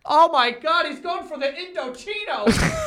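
high excited commentator shout; hyped announcer. Pretend. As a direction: call it like an extreme sports announcer. Mock BMX commentator hype.

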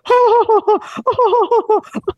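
very high-pitched, manic cackle imitation; Mozart laugh. Showing listeners the film's iconic laugh, high manic cackle. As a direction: imitate a crazy, piercing giggle. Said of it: "That's the Mozart laugh".

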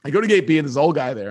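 ordinary talk

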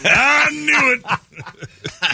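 high, drawn-out triumphant cry; hammed-up crowing.

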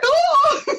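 a high, loud, hammed-up "Oh!"; mock dramatic wail. To the host, mocking her old despair with a high hammed-up wail. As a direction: throw up a theatrical cry of despair. Dramatic exclamation capping journal quotes.

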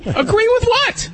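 sharp, high, offended "what?"; indignant outburst. Real, not acted. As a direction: throw the question back in disbelief. Indignant pitch jump in argument.

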